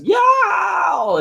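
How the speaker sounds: high drawn-out cartoon yowl; hillbilly yelp. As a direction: yelp like a flung cartoon villain. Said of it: "the hillbilly guy just goes".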